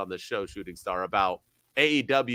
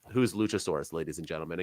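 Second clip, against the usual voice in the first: dry deadpan sarcasm; sarcastic aside, dropping much softer; 7 semitones lower, 6 dB quieter, faster than usual. Acted. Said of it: "in case you're not getting my sarcasm".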